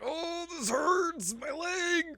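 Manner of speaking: very high, drawn-out cry of pain; mock struggling wail. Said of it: "while Goku's just struggling".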